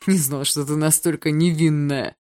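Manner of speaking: slow, teasing drawl; lazily taunting; mocking drawl. A narrator's reading. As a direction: draw it out with amused scorn. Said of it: "протянул он насмешливо".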